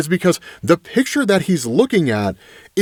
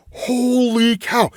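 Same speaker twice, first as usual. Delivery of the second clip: drawn-out, high amazed exclaim; slow, wide-eyed disbelief; stunned holy cow; 6 semitones higher, much slower than usual. Real, not acted. Recapping the flamethrower scene to viewers, amazed.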